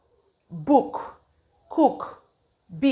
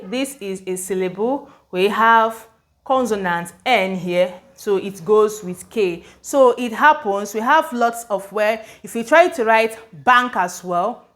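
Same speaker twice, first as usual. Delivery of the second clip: ordinary talk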